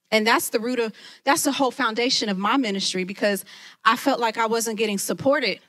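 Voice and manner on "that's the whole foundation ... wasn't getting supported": ordinary delivery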